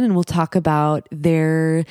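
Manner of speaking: ordinary talk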